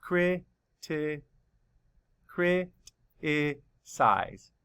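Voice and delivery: very slow, each syllable counted out. Shown as a demonstration. Teacher counting syllables slowly for the class. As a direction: stretch out every syllable slowly. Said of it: "Count the syllables".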